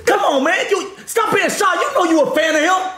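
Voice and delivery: high, laughing imitation of pestering; teasing coworker. Re-enacts coworker pushing the woman.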